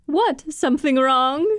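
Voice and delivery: put-on cartoon character questioning in English; New Gundam impression. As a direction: lift the pitch and do the character's voice. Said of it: "you do that voice so well".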